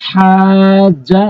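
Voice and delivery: হাজা drawn out very slowly; slow chanted stretch; madd stretch. Shown as a demonstration. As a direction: recite হাজা with a long held vowel. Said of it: "এরপর দেখুন".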